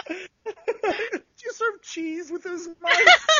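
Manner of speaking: high, loud, slow through laughter; breaking up completely while asking; helpless laughter. Real, not acted. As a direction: squeeze the joke out between laughs. Joke told in laughing fit.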